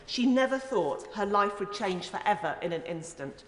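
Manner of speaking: ordinary talk, nothing marked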